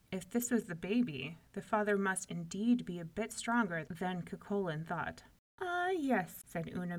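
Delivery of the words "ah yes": high, proud lilt; beaming. A narrator's reading. Una agreeing about her baby, beaming. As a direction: say it beaming with a mother's pride.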